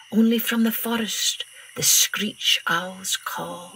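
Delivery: usual speaking voice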